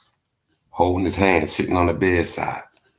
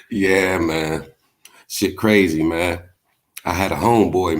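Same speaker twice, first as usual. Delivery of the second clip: usual speaking voice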